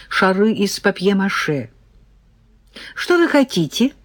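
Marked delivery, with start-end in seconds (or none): 2.9-3.9 high, eager question; keenly inquiring, pitched up; enthusiastic. A narrator's reading. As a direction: ask like an eager artist with a buyer. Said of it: "воодушевлённо поинтересовалась художница".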